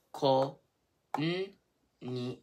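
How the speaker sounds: "Konnichiwa" as ko, n, ni, chi, wa; very slow, a pause after each beat; syllable by syllable. Shown as a demonstration. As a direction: spell out "Konnichiwa" by sound for learners. Teacher sounds out word syllable by syllable.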